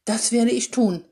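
hoch und laut, flehend gerufen; stöhnend gerufen. Acted. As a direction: verzweifelte Mutter ruft beteuernd, höher. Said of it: "rief sie stöhnend". (die Mutter beteuert laut und stöhnend ihre Liebe zum Sohn)

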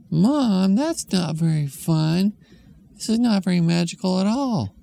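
put-on high whiny kid; a let-down little kid; child voice. Kid complaining to mom about the waiting room, high whiny voice.

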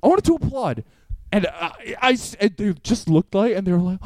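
usual speaking voice

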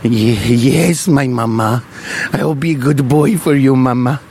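put-on low, German-accented obedient son. Ponce promising mama he'll be good, in a German accent. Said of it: "I don't know why he's German".

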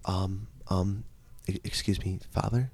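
soft, halting, hesitant request; small, quiet, tentative politeness; timid child. Retold or imitated. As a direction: stammer softly, unsure of yourself. Acts timid child addressing priest.

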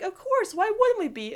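Lincoln voice. Retold or imitated. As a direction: answer her like it's obvious. Lincoln's reply voiced high.

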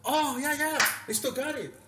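loud, high burst of recognition; high-pitched eager confirmation; imitated excited Australian. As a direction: exclaim it high and loud, suddenly thrilled. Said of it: "and he goes like that".

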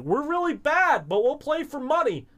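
exaggerated high-voiced act to lure a bet; [name] playing it up. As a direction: play up being hopeless, way up high. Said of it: "[name] is playing it up".

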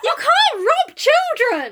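high, slow indignant cry; mock outrage. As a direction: protest in hammed-up outrage.

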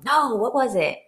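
ordinary talk

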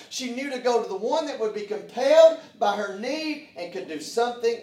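loud, high, ringing preacher's voice; full-force preaching. Acted. Preacher rousing the church at full force.

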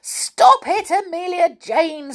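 squealing high and loud. A narrator's reading. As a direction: squeal it like a little engine. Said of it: "squealed the engine".

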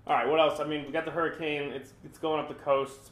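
ordinary talk

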